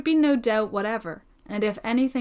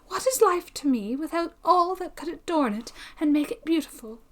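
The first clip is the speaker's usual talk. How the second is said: put-on higher, softened voice of an earnest girl; ardent young heroine; 5 semitones higher than usual. Young woman defends love's courage to a cautious friend, ardently, higher. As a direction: lift the voice into a devoted young woman's plea. Joanna declares her devotion.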